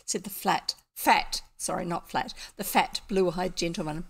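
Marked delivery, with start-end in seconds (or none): none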